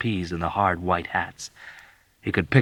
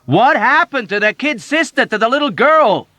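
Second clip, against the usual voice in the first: loud, high, forceful demand; raised voice; 11 dB louder than usual. Acted. Yossarian raising his voice at the unresponsive old woman. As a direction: raise your voice to get through. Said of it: "He raised his voice".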